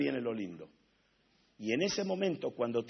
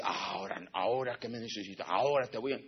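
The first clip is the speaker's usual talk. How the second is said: quiet mock threat; 6 semitones lower, 6 dB quieter than usual. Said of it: "Nunca te va a decir".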